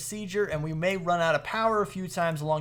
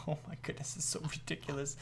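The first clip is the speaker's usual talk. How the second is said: hushed, low disbelief after a laugh; overwhelmed; 3 semitones lower, 12 dB quieter, faster than usual. Real, not acted. Gamer daunted by automating block creation, quietly overwhelmed. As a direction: say it softly, almost to yourself.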